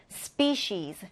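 "species" repeated as SPEE-sheez, pitched low; sh sound, final z. Shown as a demonstration. Teacher repeating the demonstration, "species" as SPEE-sheez. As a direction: model "species" with a sh in the middle and a buzzed z ending. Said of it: "이렇게 발음합니다".

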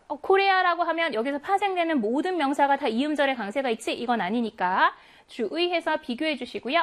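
ordinary talk, nothing marked

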